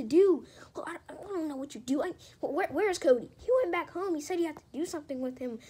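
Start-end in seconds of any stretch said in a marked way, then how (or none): none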